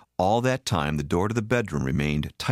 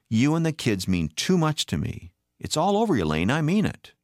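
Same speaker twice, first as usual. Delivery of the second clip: high, urgent vow; pleading promise, voice raised high; impassioned insistence; 9 semitones higher than usual. A narrator's reading. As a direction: insist desperately, pitch leaping up. Impassioned promise in marital quarrel.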